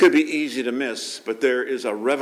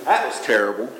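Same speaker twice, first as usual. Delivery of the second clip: inner voice of condemnation; 4 dB quieter than usual. Retold or imitated. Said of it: "voice saying".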